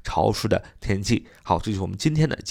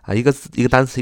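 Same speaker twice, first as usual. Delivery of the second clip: ordinary talk, nothing marked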